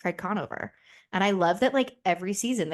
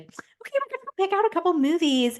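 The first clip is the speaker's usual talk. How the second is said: high, put-on sweet girlfriend voice, much higher; mock girly voice; 11 semitones higher, 4 dB louder than usual. Teen girl to boyfriend at Blockbuster, high mock sweet voice. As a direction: play the giddy teen picking movies. Playing her younger dating self.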